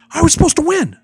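high, loud wail of injustice; outraged protest. Acted. Eliminated wrestler to the referee, high outraged protest. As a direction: cry foul at the top of your voice.

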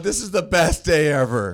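high, gleeful secret pride; proud inner voice. Pretend. Friend voicing the proud dad's inner thoughts, high and gleeful. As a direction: voice the dad's hidden delight. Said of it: "Inside, he's going".

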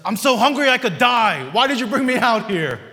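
loud, high mock grumbling complaint; hammed-up angry griping; complaining whine. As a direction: whine it out like a fed-up Israelite. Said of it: "It's a".

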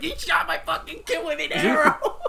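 high, strained mock outrage, half laughing; hammed-up angry father through laughter; imitating furious dad. Retelling a Sopranos scene, mock furious dad yelling. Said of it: "he's like furious at him".

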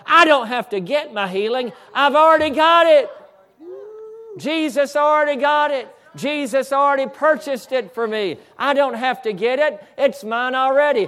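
high, loud preacher's triumph, retelling a retort; defiant shout. Retold or imitated. Healed man telling Mister Devil off, defiant shouted retort. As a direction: shout it down as a victorious claim.

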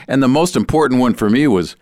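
plain reading voice

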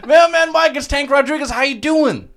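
high, loud, excited hello; excited greeting. Real, not acted. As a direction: greet him with a big grin. Greeting the guest on the phone.

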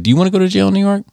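high, fast inner-voice question; little inner voice. Retold or imitated. Conscience interrupting a man mid-fight, high little voice. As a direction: pipe up as a quick little conscience. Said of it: "it was a little voice".

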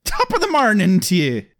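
lilting Irish greeting; Irish accent. Pretend. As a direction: put on an Irish brogue. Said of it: "Wrong accent".

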